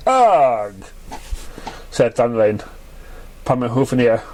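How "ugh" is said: disgusted groan. Acted.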